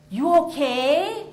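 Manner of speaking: put-on slow, gentle Vietnamese-accented question. Cab driver asking a soggy passenger, Vietnamese accent. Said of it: "he looks back and he says".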